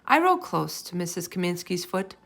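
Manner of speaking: ordinary delivery, nothing marked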